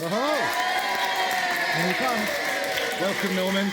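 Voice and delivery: excited welcome. Acted. Greeting guest, crowd-hype shout.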